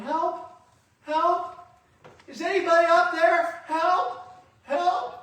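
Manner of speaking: high, loud desperate cries; panicked shouts for help; calling for help. Acted. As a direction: yell up the cliff for rescue. Man hanging from cliff calling.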